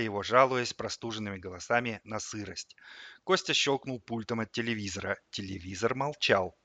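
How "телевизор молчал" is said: ordinary delivery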